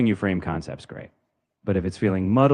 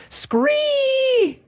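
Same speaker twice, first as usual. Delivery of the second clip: piercing high screech; pterodactyl shriek; 5 dB louder than usual. Acted. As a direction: swoop down with a shrill scree. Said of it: "your best pterodactyl impression".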